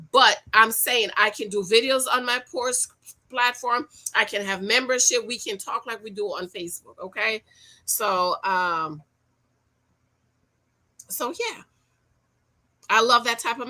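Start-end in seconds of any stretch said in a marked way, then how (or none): none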